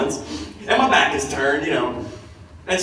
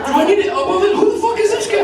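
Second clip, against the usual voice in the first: high, loud comic indignation; mock outrage; 5 dB louder, faster than usual. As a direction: demand it in exaggerated disbelief. Retells his own outraged reaction.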